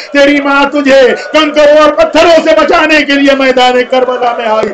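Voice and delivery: grief-laden climax of the sermon; impassioned majlis preaching. Acted. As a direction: build the lament to full force.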